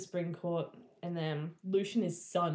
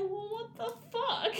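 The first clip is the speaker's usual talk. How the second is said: outraged quote; slower than usual. Retold or imitated. Retelling Tamlin's outraged reaction.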